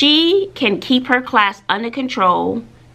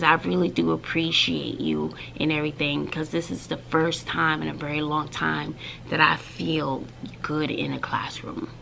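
hushed quote; 4 semitones lower, 8 dB quieter than usual. Retold or imitated. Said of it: "And she says".

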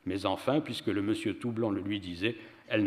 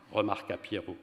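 ordinary delivery, nothing marked

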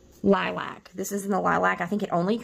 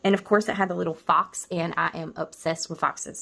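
normal talking voice

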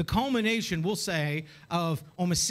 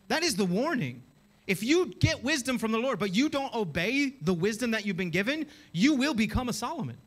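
ordinary talk

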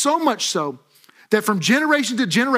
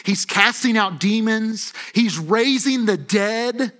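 usual speaking voice

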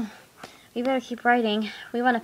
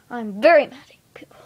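whisper; 4 dB louder than usual. Pretend. Whispered aside.

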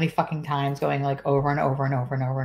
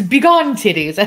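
loud, raised mock command; mock grand banishing; 4 semitones higher, 10 dB louder than usual. Imagining Cal banishing his chest with a loud mock command. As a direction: boom it out like a wizard casting them away.

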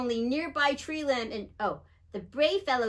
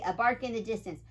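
usual speaking voice

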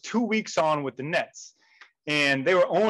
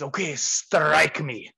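put-on slow Russian-accented command; measured, heavy Russian accent; 3 semitones lower, much slower than usual. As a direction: imitate the Russian boss, drawn out and firm. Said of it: "in a thick Russian accent".

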